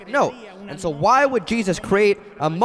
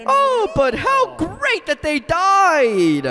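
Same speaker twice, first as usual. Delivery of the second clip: high, drawn-out mock rejoicing; hammed-up celebration; imitating jubilant founder; 11 semitones higher, 3 dB louder, much slower than usual. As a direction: crow it slowly, as if at great news. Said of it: "he would always come in and say".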